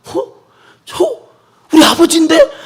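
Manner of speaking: startled, rising "어?" as he spots his father; surprised son. Acted. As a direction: catch sight of him and gasp in disbelief.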